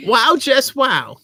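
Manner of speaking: loud, high, drawn-out put-on voice; character voice. Shown as a demonstration. Husband auditioning a voice to read the review, character voice. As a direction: play it big, slow and high. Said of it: "Well, you can do voices".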